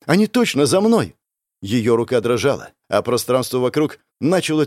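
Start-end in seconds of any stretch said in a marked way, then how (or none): 0.1-1.2 high, alarmed voice; scared, pitched-up insistence; frightened. A narrator's reading. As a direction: insist in rising panic. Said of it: "испуганно прошептала Линет".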